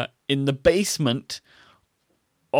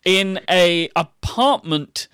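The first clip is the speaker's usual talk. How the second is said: slow deliberate; 5 semitones higher, 5 dB louder, much slower than usual. Pretend. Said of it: "let me see this in Matt speak".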